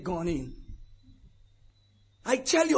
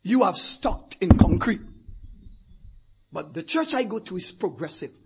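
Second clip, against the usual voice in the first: normal talking voice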